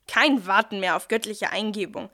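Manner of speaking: upbeat salesman pitching a phone; werbesprecher. Acted. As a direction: sell it like a TV ad host. (enthusiastische Werbestimme)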